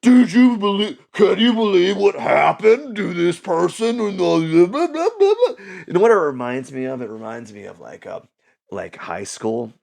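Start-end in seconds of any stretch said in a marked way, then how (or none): 0.0-5.5 higher, louder mock gossip voice; mocking drama channels hyping news; gossip vlogger. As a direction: ham up a gossipy commentary channel. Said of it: "just current events".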